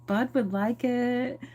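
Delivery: high, slow sugary angel; sweet angel voice. Retold or imitated. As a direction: coo it like a little angel. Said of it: "the angel's like".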